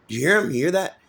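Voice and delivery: high, rising disbelief at what he just heard; excited paramedic. Retold or imitated. Medic to crew, startled and high-pitched. Paramedic reacting to heroin answer.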